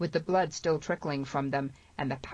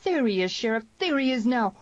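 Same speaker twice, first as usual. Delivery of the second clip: high, excited shout; 8 semitones higher, 5 dB louder than usual. A narrator's reading. Harry alerts the sheriff to the riders, shouting. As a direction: call out the sighting, high and urgent. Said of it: "Harry shouting".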